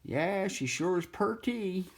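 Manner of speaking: high, slow hillbilly drawl. Retold or imitated. Cartoon yokel admiring the drawing, high slow drawl. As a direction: ham up a goofy yokel. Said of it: "this guy going saying".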